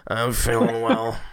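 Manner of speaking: slow put-on sick voice; faking being sick. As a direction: play sick on the phone to the boss, slowly. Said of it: "call in sick voice".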